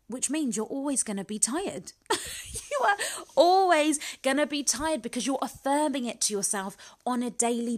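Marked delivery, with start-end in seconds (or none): 2.0-2.7 brief breathy burst of laughter; a quick real laugh; short laugh. Real, not acted. Host amused at her point about always being tired, short laugh.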